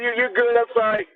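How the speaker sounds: ordinary talk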